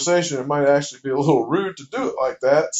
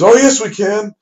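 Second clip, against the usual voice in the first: dad's raised, higher "oh, yes, we can"; eager dad seeing dollar signs, louder and higher; louder insistence; 5 semitones higher, 6 dB louder than usual. Retold or imitated. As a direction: push back hard, pitch up.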